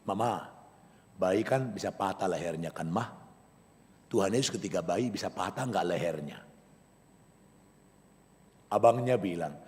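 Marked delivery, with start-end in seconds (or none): 0.0-6.4 put-on much softer, a child's curious question; soft, curious little boy's question to mama; small child asking. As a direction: ask it like a small boy at bedtime. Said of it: "Dia langsung kepikir".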